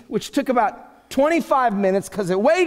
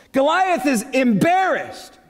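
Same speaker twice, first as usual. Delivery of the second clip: ordinary talk, nothing marked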